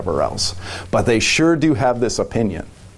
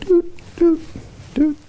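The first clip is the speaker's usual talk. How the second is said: high, short "doot" drips; tiny dripping sounds; slower than usual. Retold or imitated. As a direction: mimic stingy little drops in a high pitch. Mimics tiny drips of water.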